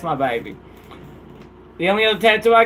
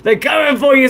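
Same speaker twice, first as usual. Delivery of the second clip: playful shout; 9 semitones higher than usual. Real, not acted. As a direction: shout a playful warning to a viewer. Shouting at Solo Dolo.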